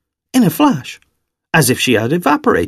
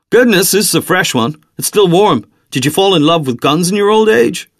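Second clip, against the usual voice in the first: higher-pitched salesman's patter; teasing salesman; 6 semitones higher than usual. Acted. Salesman remarks on Xavier's fresh license, light teasing. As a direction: tease the customer lightly.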